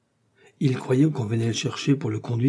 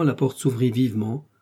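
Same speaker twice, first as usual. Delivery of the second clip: plain reading voice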